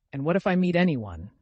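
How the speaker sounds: plain reading voice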